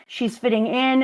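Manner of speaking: ordinary talk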